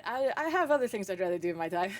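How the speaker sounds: ordinary talk, nothing marked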